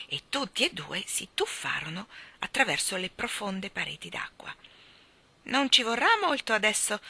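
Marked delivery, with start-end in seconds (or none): 5.4-7.0 lightly voiced little creature, raised pitch; higher, louder, encouraging small voice; reassuring little voice. A narrator's reading. Unseen guide assures the children, little reassuring voice. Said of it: "li assicurò la vocina".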